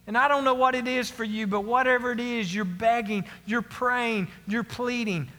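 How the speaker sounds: ordinary delivery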